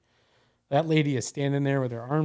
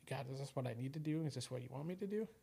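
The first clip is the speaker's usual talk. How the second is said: very soft, questioning inner voice; little inner voice; 15 dB quieter than usual. Retold or imitated. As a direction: voice a private thought, much softer, like a prayer. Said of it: "listening to that little voice in my head".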